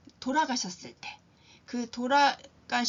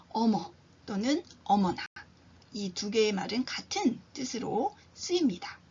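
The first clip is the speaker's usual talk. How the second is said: ordinary talk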